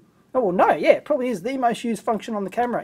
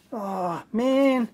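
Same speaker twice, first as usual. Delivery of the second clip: ordinary talk, nothing marked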